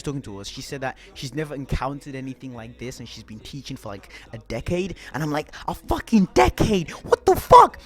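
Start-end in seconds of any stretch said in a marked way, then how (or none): 5.7-7.8 loud, high yell of disbelief; shocked outburst. Retold or imitated. Student reacting to teacher's decade of teaching, shocked shout. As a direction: build to an incredulous shout, shooting up in pitch.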